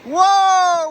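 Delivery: loud, high, thrilled whoop; excited whoop. Real, not acted. Vlogger dunks into the icy river after a countdown, loud high whoop. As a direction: whoop as the cold water hits.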